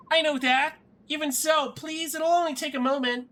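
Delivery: put-on high, girlish insistence; pleading young girl. Mist begging Ike for a moment, high girlish plea. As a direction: plead in a high little-sister voice.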